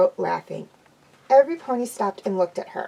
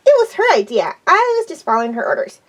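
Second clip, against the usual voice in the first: high, louder tattling pony; Pinkie's voice; 6 semitones higher, 8 dB louder than usual. A narrator's reading. Pinkie ratting on Twilight, high and loud. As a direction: rat her friend out brightly.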